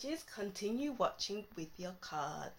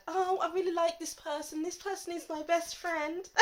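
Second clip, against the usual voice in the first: higher-pitched, hammed-up gushing; mock gushing; 7 semitones higher than usual. Imitating people fooled by someone iffy, mock gushing. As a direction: imitate someone naively smitten with a new friend.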